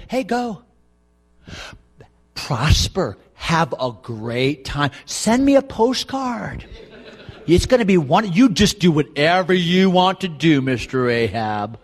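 slow, drawn-out mock encouragement; sarcastic. Shown as a demonstration. Micaiah telling Ahab to go ahead, drawling sarcasm. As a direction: send him off with heavy sarcasm. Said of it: "Micaiah is being sarcastic".

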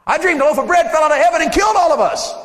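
high, loud frantic telling; scared soldier shouting his dream; alarmed soldier. Acted. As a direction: blurt the dream out in a panic.

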